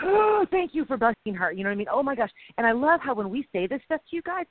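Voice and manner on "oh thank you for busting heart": mock breathless thanks. Acts out a breathless prayer.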